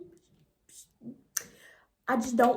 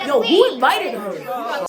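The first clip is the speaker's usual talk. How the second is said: much louder, slow, indignant call-out; outraged partygoer; 2 semitones higher, 11 dB louder, slower than usual. Retold or imitated. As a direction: call her out to the room. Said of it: "they're like".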